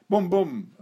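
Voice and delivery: mock gun sounds, boom boom; gunshot noises. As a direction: say it like a kid firing a toy gun. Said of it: "And then you say".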